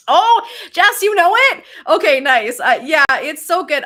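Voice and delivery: high, loud, delighted outburst; excited. Real, not acted. To a viewer who knows the show, delighted and loud. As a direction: squeal with delight at a fellow fan.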